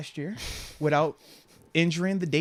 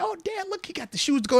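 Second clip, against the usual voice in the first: high mock-excited teen girl voice; excited daughter; 12 semitones higher than usual. Dad imitating his daughter showing off her date. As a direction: squeal it like a thrilled teenage daughter. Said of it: "She's excited".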